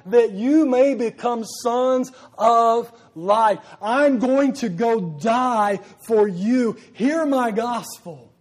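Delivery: slow and loud, voicing Jesus' offer; preaching at full force. Preacher voicing Jesus to the congregation, slow impassioned preaching. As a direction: plead each phrase from the pulpit.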